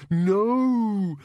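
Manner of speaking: high, long wailed monster protest; high, drawn-out creature moan; dismayed bogeyman. Acted. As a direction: wail it as a horrified bogeyman. Bogeyman dreads the blanket.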